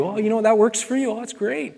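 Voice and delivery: dismissive mock. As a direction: mimic a coworker shrugging it off. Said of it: "heard that from your coworkers".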